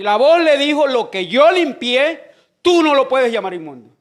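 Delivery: loud preacher's delivery with drawn-out key words; preaching. Real, not acted. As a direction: proclaim the Bible story to the congregation. Preaches the heavenly voice's answer.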